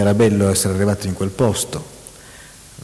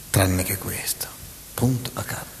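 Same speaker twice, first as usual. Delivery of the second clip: whisper; 9 dB quieter than usual. Acted. Parodying melodramatic novel dialogue.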